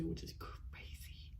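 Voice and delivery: whispered aside. Real, not acted. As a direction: drop to a slow whisper.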